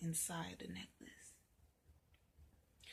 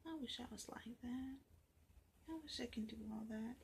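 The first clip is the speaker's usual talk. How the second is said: whispered inner wish; 3 semitones higher, 9 dB quieter, faster than usual. Acted. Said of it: "she's thinking".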